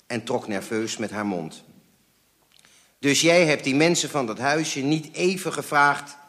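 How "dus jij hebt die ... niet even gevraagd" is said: higher, louder biting question; biting reproach. A narrator's reading. Father reproaching mother over the house, biting. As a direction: go at them with a sharp accusation. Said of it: "beet mijn vader haar toe".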